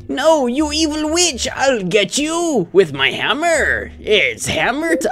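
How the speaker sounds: high, slow hero cry; heroic shout. Acted. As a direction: call out the villain slowly. Hero yells at the witch.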